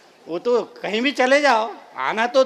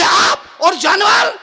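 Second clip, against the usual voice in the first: high, loud astonished question; incredulous wife; 9 semitones higher, 7 dB louder, slower than usual. Acted. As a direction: balk in loud disbelief. Wife disbelieves husband's agreement. (हैरानी में ऊँचा सवाल)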